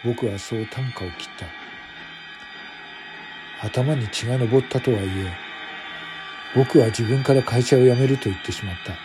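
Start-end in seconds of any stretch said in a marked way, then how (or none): none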